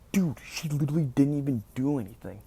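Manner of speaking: re-enacted indignant objection; retold indignant protest. Acted protest defending the girlfriend.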